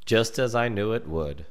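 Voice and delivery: ordinary delivery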